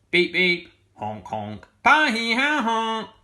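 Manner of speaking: loud, high honking horn sounds; horn noises. A narrator's reading. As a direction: honk like three silly horns. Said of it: "went Missus Armitage on her horns".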